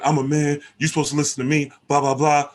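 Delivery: loud, high, hammed-up tough guy; mock angry man. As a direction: play the macho man who won't be told, pitched high. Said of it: "he's not just".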